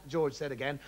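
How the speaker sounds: ordinary delivery, nothing marked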